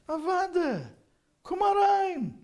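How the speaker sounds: high, loud, slow, joyful call to come in; warm elder welcome. Retold or imitated. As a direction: welcome him in like a beaming old rebbe. Rebbe insists, welcoming.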